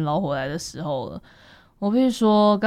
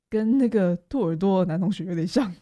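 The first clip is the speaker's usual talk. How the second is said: normal talking voice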